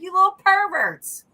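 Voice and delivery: high, slow, loud mock scold at the critters. Retelling her reaction to a peeking animal, in a loud, high mock scold. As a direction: playfully scold the peeking creatures, high and drawn out. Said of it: "I'm like".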